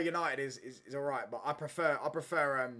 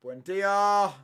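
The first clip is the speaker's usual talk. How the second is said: sudden loud call of a player's name, higher and louder; excited shout; 6 semitones higher, 12 dB louder than usual. Real, not acted. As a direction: shout the name at the screen as the chance comes. Calling out a goal chance.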